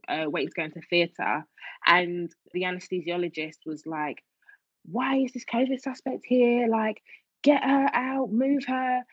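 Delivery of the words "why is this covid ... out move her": imitating angry doctor. Anaesthesiologist ordering the covid suspect moved, mock angry. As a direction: snap it like an irritated doctor.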